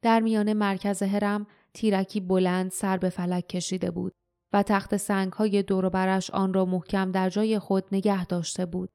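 ordinary delivery, nothing marked

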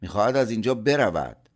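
the manager fuming to the engineer; angry. A narrator's reading. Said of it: "مدیر با عصبانیت گفت".

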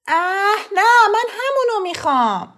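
put-on high, loud whining boy; whiny child. A narrator's reading. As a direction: insist like a sulky kid.

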